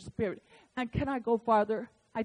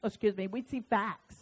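usual speaking voice

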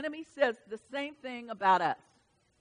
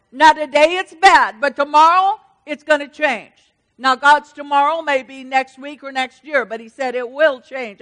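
full-force preaching; 4 semitones higher, 14 dB louder than usual. Acted. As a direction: drive the crowd with rising fervor.

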